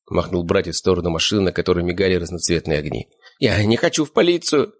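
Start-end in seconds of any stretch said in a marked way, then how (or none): 3.4-4.7 high-pitched whiny protest; plaintive, wimpy refusal; whining. A narrator's reading. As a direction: whine like a reluctant captive. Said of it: "законючил Щуплый".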